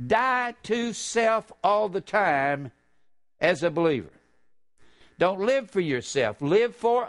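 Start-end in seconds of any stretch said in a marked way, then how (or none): 0.1-2.7 drawn out slowly, pitched higher; slow emphatic preaching. Real, not acted. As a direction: stretch each word to drive it home.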